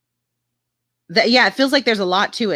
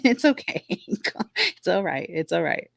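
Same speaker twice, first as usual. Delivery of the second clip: soft reassurance; 5 dB quieter, slower than usual. Real, not acted. Comforting viewer Jamie Lynn after laugh.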